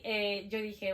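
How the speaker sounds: usual speaking voice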